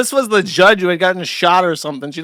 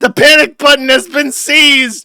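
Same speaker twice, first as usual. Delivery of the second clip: loud, high alarmed proclamation; mock announcement; 6 semitones higher, 4 dB louder than usual. Streamer calling out the panic button to chat, loud mock alarm. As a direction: announce the seized button like breaking news.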